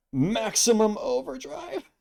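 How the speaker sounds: high, slow drawn-out scream; screamed catchphrase. Acted. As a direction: scream it like a cartoon battle cry. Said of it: "He, like, screams it".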